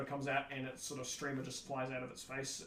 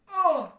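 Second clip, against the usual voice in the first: loud, high hammy death cry; dying cry; 16 dB louder than usual. Retold or imitated. Actor walks on stage and pretends to die, hammy death cry.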